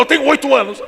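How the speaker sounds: loud, mock cavernous retort; hammed-up imitation of a hard reply. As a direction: boom it out to outdo the pastor. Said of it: "fez uma voz mais cavernosa que a minha".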